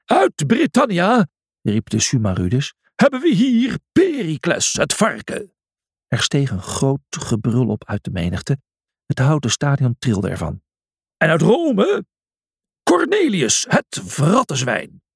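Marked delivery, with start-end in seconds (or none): 0.1-5.5 announcer calling out. Acted. Announcer calling out arena fighters. 11.2-15.0 announcer calling out. Acted. Announcer introducing the next fighter.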